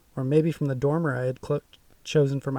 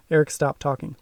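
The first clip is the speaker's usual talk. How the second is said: usual speaking voice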